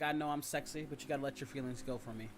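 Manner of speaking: usual speaking voice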